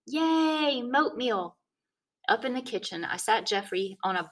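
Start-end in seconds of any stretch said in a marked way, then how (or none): 0.1-1.6 put-on kid cheering. A narrator's reading.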